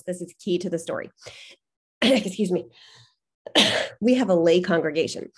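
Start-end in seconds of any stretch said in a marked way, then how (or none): 1.6-3.6 throat catching, clearing it; words through a choked throat; choking on spit. Real, not acted. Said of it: "I'm literally choking on my own spit".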